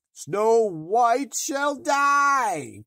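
high, loud, slow shriek of rage; furious cry. A narrator's reading. Queen screaming her vow in rage, loud and high. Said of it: "cried she".

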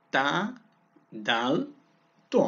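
ordinary talk, nothing marked

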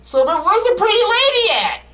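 high sing-song taunt; mock sing-song call. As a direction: call out for the ghost teasingly. Calling for the ghost.

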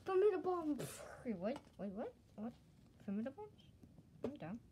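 normal talking voice

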